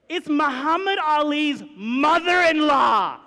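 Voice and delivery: loud, slow, high announcement; excited reveal. Real, not acted. Big reveal punchline.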